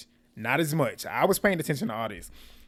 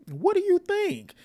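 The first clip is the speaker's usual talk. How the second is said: very high, drawn-out imitated comeback; incredulous mock retort; 4 dB louder, slower than usual. Recapper voicing jilted groom asked if okay, high mock retort. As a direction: squeal it slowly as a mock retort.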